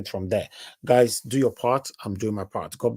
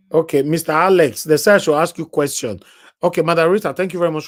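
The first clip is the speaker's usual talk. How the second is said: raised voice, bright and loud; 5 semitones higher, 7 dB louder, faster than usual. Real, not acted. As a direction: announce it loudly. Loud host announcing.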